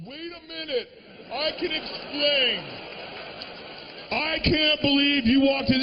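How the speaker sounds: high, pleading excuses; mock panic. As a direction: scramble to explain yourself. Caught person panicking excuses.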